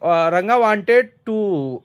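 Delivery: slow read-aloud. Shown as a demonstration. Teacher rereads sentence start.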